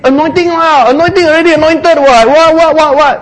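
high, loud, rushed babble; overexcited believer, sent up; mock excitement. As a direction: gush as if overcome, hammed up. Acts out excited, over-eager believers.

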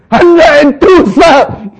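high, loud answer; loud report. Acted. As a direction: shout the total. Soldier's dawning answer.

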